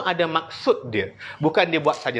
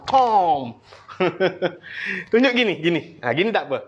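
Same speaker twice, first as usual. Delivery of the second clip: ordinary talk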